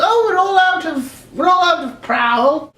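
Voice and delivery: high, slow put-on lady; stretched mock-regretful lady voice; apologetic clerk lady. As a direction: break bad news in a drawn-out high voice. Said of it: "this was a different lady altogether".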